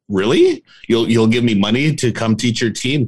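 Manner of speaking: can't believe they'd pay; surprised disbelief. Retold or imitated. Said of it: "I'm like".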